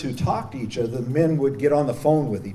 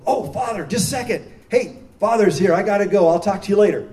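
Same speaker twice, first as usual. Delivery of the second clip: higher, hurried inmate voice, a bit louder; inmate caught on the drain phone; 7 semitones higher, 7 dB louder than usual. Retold or imitated. Inmate cuts his drain call short for the priest, higher and hurried. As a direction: rush off the call, startled by the priest. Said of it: "He'd go".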